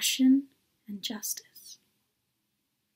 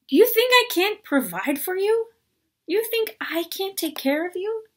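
higher, exasperated parent asking; disappointed parent; 7 semitones higher than usual. Retold or imitated. Disappointed God asking a doubting child, pitched up. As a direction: sound let down by your own child. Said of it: "this disappointed parent saying".

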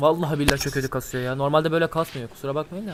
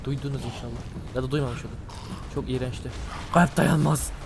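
ordinary talk, nothing marked

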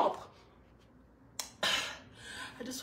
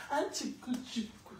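ordinary delivery

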